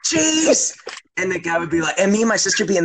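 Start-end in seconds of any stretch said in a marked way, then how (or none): 0.0-0.7 high, halting one-word blurts; panicked dad imitation, very high-pitched; nervous dad ordering. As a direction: blurt each word high, with long gaps. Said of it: "this is what he would say".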